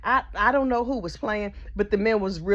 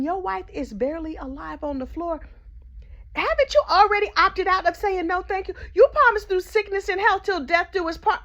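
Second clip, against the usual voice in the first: indignant scold; 10 semitones higher than usual. Real, not acted.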